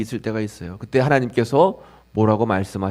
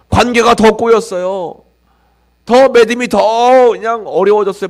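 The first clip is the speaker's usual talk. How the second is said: 높고 큰 목소리로 쏟아내는 하소연; 기도해도 꼬이는 답답함을 터뜨리며; 답답한 하소연; 9 semitones higher, 7 dB louder than usual. Acted. 낙심한 신자의 하소연 재현. (낙심한 신자가 하나님께 높고 크게 하소연)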